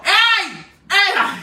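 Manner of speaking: high, loud call after a thief; retold shout. Yelling at Sara as she walks off with the charger, loud and high. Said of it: "Y le digo".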